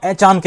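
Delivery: "अचानक" as ए-चान-कै, न joined to चा; wrong break. Shown as a demonstration. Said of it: "इस तरह से तोड़ते". ("अचानक" को अ-चान-कै बोलें)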